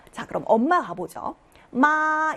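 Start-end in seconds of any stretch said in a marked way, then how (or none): 1.7-2.3 마 held high and level; first tone. Shown as a demonstration. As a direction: sustain each 마 on one high, even note. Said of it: "일성이었습니다".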